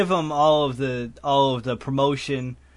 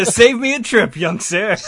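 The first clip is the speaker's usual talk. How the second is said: loud, high, hammed-up gratitude; acted delight; 6 semitones higher, 7 dB louder than usual. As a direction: gush like a delighted customer. Acts the delighted customer.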